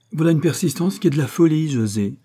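smiling reply. A narrator's reading. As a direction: brush it off with a smile in the voice. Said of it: "répondit Dolci en souriant".

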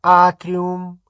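high, even announcement, projected clearly; level, drawn tones of a lift voice; calm witch announcer. A narrator's reading. Lift voice calls out floors, calm witch announcer. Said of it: "произнёс спокойный голос колдуньи".

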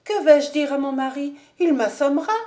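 high, loud wail of worry; dismayed cry. A narrator's reading. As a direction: fret aloud in alarm. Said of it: "s'écria mistress Girder".

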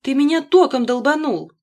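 loud, high shocked outcry; startled, accusing yell; frightened. A narrator's reading. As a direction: shout it in alarm. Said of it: "испуганно глядя на меня".

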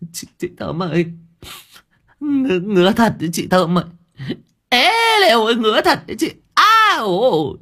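put-on whining, itching boy. A narrator's reading. Boy begging Thơm about the itch, high whiny wail.

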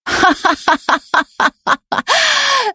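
high, unhinged laughter falling away; hysterical laugh. Acted. Knife-wielding girl laughing hysterically at Dan through tears. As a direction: laugh like she has snapped. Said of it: "истерично засмеялась".

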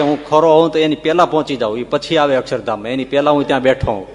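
loud, challenging, speeding up at the end; defiant loud vow. Retold or imitated. Sachchidanand Swami answers Maharaj's vow with a loud challenge. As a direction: throw the vow back boldly.

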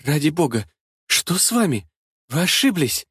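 helpless, faltering murmur; frightened babble. A narrator's reading. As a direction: falter out a protest in short pieces. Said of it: "пролепетала фрау Ирена".

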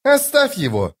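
sharp high order; commanding order. A narrator's reading. Corporal ordering Detoran off the sapper, sharp command. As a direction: order her to stop. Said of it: "приказала Хватка".

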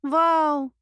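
loud but not quite confident; obedient unsure wow. A narrator's reading. Repeating her friend's wow, loud yet unsure. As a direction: shout it on command without much conviction. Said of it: "послушно, но не очень-то уверенно повторила".

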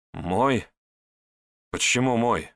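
mild puzzled surprise; surprised. A narrator's reading. Andrey reacting to praise of his fight, mildly surprised. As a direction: question it with a touch of disbelief. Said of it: "удивился Андрей".

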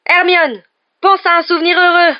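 high, full-force shout; urgent, very loud high cry; yelled instruction. A narrator's reading. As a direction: yell desperately to a companion. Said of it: "hurla Harry".

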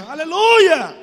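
high, very loud imitated response; fervent church sister. Church sister answers the pastor, loud mock fervour. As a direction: belt out a congregant's praise cry. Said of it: "A irmã".

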